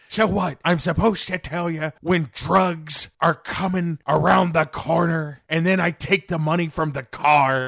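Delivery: loud, high hammed-up Joker; mock Joker runner. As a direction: play the villain as a cocky errand boy. Low level Joker voiced as runner.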